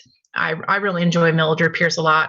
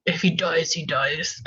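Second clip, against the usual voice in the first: put-on terrible Russian accent; 4 dB quieter, slower than usual. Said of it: "That was a terrible Russian accent".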